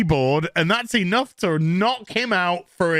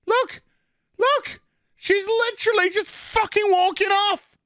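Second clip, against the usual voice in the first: outraged disbelief; 12 semitones higher than usual. Real, not acted. Outraged at the scene.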